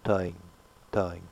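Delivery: toing fading out on a nasal vowel; soft nasal toing with no ng; correct toing. Shown as a demonstration.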